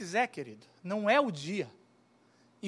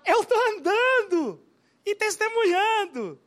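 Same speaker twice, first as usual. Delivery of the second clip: joyful healed man; 8 semitones higher, 6 dB louder, slower than usual. Acted. Healed man answers the priest.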